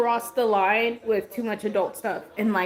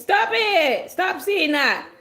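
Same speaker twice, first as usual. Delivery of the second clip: louder and higher, stop it; exasperated scolding; 6 semitones higher, 4 dB louder, slower than usual. Real, not acted. Mom telling her son to stop teasing, exasperated.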